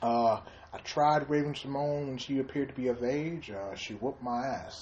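low, very soft celebrity impression; mock confession, quietly in character; Bill Cosby.

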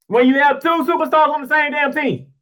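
high-pitched, indignant disbelief; exasperated outburst. Real, not acted. Host ranting over who took the shot, exasperated outburst. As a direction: cry it out high, fed up with the choices. Exasperated rant about Boston's superstars.

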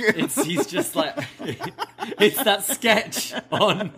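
talking through laughter; slowed, giggly explanation; cracking up mid-sentence; laughing. Real, not acted. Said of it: "laughing while explaining".

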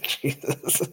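laughing. Real, not acted. Reacts to a teammate's jab.

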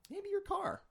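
jokey high squeak; squeaky high voice. Pretend. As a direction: pop the line up high. Squeaky joke aside.

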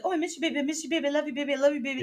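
high, gushing mock sweet talk; lovey-dovey. Mimicking a girlfriend on the phone, lovey-dovey. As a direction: imitate a smitten phone call, sickly sweet. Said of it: "lovey-dovey".

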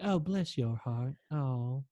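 slow, soft Southern sympathy; really sweet Southern. Retold or imitated. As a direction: coo it gently like a sweet Southern stewardess. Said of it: "She was, like, really sweet".

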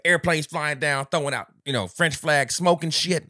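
hyped, loud and high; excited. Real, not acted. Excited friend hyping up the race opening.

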